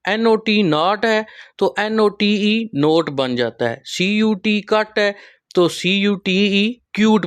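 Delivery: slowly spelled words, short then long vowel; नॉट to नोट, कट to क्यूट, spelled out slowly; teacher drilling vowel pairs; slow spelling demo. Shown as a demonstration. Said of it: "long और short वॉल sound".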